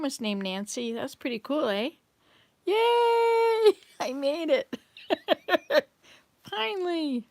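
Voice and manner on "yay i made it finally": excited cheer. Real, not acted. Cheers after finishing something.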